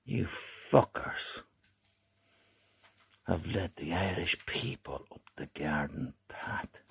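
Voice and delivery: anger held down to a whisper; seething whisper. Real, not acted. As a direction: hiss it out in a low fury. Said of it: "Watch the anger".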